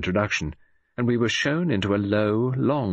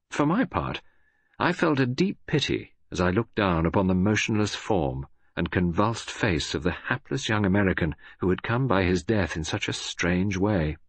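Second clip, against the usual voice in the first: ordinary delivery, nothing marked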